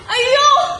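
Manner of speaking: theatrical, rising wail of dismay; acted lament. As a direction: wail it out over a loss. Minister laments his lost ring.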